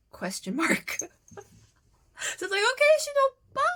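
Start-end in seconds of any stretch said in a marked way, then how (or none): 2.7-3.7 high, loud squeaky reply; mock squeal. Fan trying out a new nickname, high playful squeal. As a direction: squeal it out, much higher and louder. Acts out her awkward reply, laughing.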